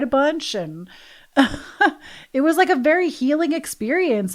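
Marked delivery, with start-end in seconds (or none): 1.3-4.3 higher and louder through a laugh; laughing joy. Real, not acted. Telling listeners about a healing day, laughing. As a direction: bubble over with happy laughter. Laugh then happy high speech.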